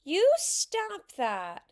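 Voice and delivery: high, loud, drawn-out scolding; outraged little-girl protest; indignant protest. A narrator's reading. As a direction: tell them off, slow and loud. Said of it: "said Susan".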